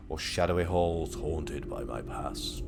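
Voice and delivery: slow, drawn-out melodramatic musing; hammed-up haunted monologue; mock horror narration.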